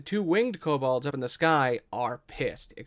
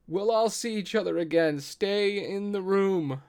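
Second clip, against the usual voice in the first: kobold yelling out; 7 semitones higher than usual. Acted. Last kobold to its dying family, high yelled promise. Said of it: "you hear the last kobold yell out".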